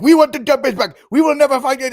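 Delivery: high, loud whiny mock Zelensky. Host mocking Zelensky demanding the Donbass back, high and whiny. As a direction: pitch it up and whine like a loud petulant kid. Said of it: "Zelensky's gonna be like".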